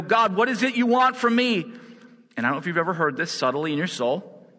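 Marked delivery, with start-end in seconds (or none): 0.0-1.6 higher, louder, pressing demand; exasperated plea to God. Retold or imitated. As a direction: throw the question up at God. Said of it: "Sometimes I go".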